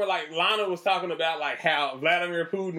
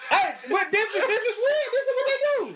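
much higher, laughing through it; laughing disbelief; faster than usual. Real, not acted. Host laughing at a clip, high-pitched. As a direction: giggle at how weird it is.

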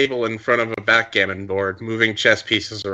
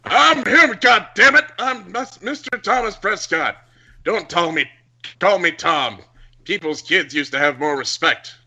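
put-on angry old man; 7 semitones higher than usual. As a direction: snap at the kids like a grumpy elder. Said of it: "slammed his fist on the table".

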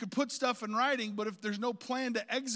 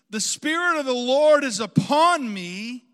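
slow, very loud scripture reading; preaching proclamation; 4 semitones higher, 14 dB louder, slower than usual. Real, not acted. Preacher opens the reading from Luke, loud proclamation. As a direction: proclaim the verse at full force.

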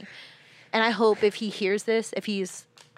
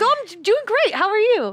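much higher, louder fake-bright reply; much higher, louder, forced-cheerful answer to an unknown man; 13 semitones higher, 6 dB louder than usual. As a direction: cover embarrassment with forced brightness. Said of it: "It's like".

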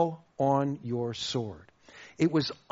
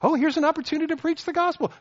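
eager Paul; 11 semitones higher, 4 dB louder, much faster than usual. Retold or imitated.

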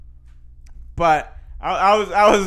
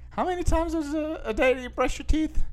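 higher, probing dentist voice; quizzing dentist; 10 semitones higher than usual. Retold or imitated. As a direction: ask it like a suspicious dentist. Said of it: "the dentist's like".

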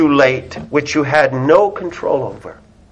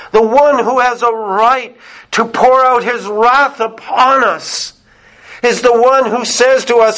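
higher-pitched, fervent sermon delivery; impassioned preaching; 7 semitones higher than usual. Acted. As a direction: preach the scripture with rising urgency. Preaches psalm at full force.

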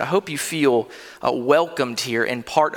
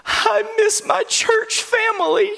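put-on much higher, slow, tearful child voice; crying little girl; slower than usual. Four-year-old daughter sobbing to her dad, high tearful child voice. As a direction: imitate a weeping small child.

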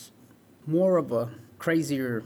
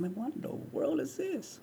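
bewildered; 12 semitones higher, 9 dB quieter than usual. Retold or imitated. Retold raindrop bewilderment.

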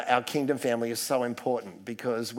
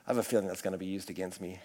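mumbled joke aside; 8 semitones lower, 9 dB quieter, faster than usual. Real, not acted. Joking aside to the congregation.

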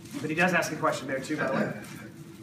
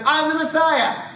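much higher and louder, mock-grand proclamation; blunt announcement; 10 semitones higher, 8 dB louder, slower than usual. Jesus just announcing himself, a hammed-up grand claim. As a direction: announce it like a boastful herald. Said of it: "If Jesus goes".

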